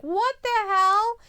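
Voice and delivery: loud, slow stunned exclamation; shocked. Retold or imitated. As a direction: blurt it out in disbelief. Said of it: "His face was just like".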